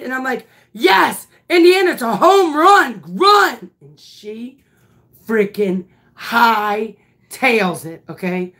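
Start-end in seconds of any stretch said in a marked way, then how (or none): 0.7-3.7 high, loud celebratory call; excited cheering. Retold or imitated. Coach cheering the batter's home run, excited.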